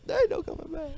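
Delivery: high mock villain quote. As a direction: ham the villain line. Echoing T-Bird quote.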